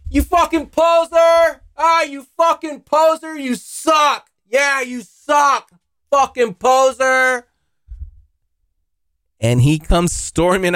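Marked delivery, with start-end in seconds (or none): 0.1-7.4 high-pitched drawn-out heckling yell; jeering reenacted shout, way up high; yelling taunt. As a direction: holler insults across the street, stretching the words. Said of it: "So I start yelling at this guy".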